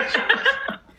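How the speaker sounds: high breathless laugh through the words; incredulous laughing squeak; laughing disbelief. Retold or imitated. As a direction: squeak it out while cracking up. Laughing disbelief in retold quote.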